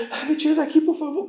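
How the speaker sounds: high, loud wailing beg; hammed-up sob to get out; mock crying plea. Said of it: "Começou a chorar". (homem chorando ao pintor, choro encenado)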